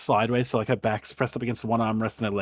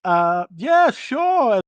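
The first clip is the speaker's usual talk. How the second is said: high, jittery guard caught off guard; a nervous guard stalling at the gate, much higher; flustered guard; 9 dB louder, much slower than usual. Acted. Guard greeting a visitor at the gate, high and flustered.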